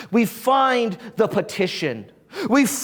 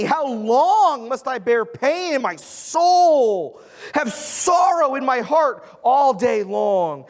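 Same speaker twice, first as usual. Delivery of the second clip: raised, pleading psalm reading; scripture reading; 5 semitones higher than usual. Acted. Pastor reading the psalm to his congregation, raised and pleading. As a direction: read the lament out as if crying to heaven.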